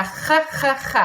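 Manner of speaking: each "G" a rasping "kha" from the back of the throat; throaty G sound. Shown as a demonstration. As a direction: scrape out every "G" as if clearing phlegm. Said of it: "you've got that G sound".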